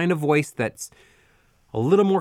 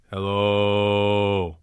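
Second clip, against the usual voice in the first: very low hello, hold out the O; low held hello; 6 semitones lower than usual. Shown as a demonstration. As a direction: rumble a long low hello. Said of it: "Try it".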